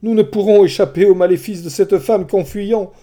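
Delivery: anxious urging; insisting. Acted. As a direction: press his point in fear. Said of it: "Euryloque insista".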